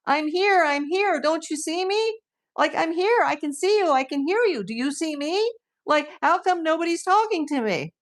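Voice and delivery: high-pitched mock pleading for attention; needy viewers. Imitates confused viewers seeking attention.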